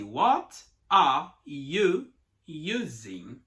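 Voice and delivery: slow, every word pulled apart. Shown as a demonstration. Teacher showing the wrong, choppy way, slow and separated. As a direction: say each word separately, slowly. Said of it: "você não pode falar assim".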